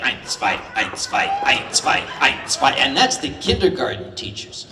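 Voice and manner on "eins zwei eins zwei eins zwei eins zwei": clipped German counting, eins zwei; German drill count. Retold or imitated. Comic imitating German kindergarten teachers counting in strict drill style. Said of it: "the Germans are like".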